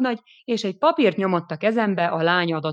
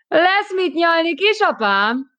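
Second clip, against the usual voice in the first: mocking taunt; 11 semitones higher, 5 dB louder, slower than usual. Girl taunting the officer.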